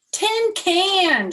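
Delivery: high, loud Tin can; an eager girl shouting the answer. A narrator's reading. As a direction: blurt it out eagerly. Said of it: "she shouted".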